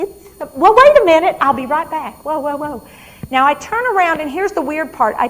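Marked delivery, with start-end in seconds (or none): 0.0-2.9 high and loud, rushing off; eager patient dashing for a photo; excited hurry. Acted.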